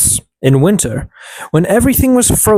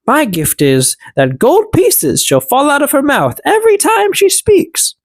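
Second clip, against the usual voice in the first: second elf; 11 semitones higher than usual. A narrator's reading. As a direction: draw out the last words like a spell. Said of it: "The second said".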